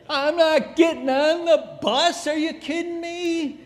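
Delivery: high, loud mocking voice of excuses; cartoonish excuse character yelling. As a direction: play the whiny little excuse voice, loud.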